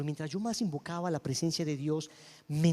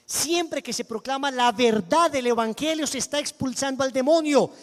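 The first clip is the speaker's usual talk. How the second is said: loud, slow, rising sermon delivery; preaching at full force; 6 semitones higher, 7 dB louder, slower than usual. Acted. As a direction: thunder the verses at the church. Preaching scripture to congregation.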